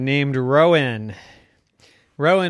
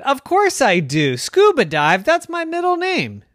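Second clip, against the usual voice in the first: rising proud boast; a neighbor showing off; boastful old neighbor; 12 semitones higher, 5 dB louder than usual. Acted. Joe boasts.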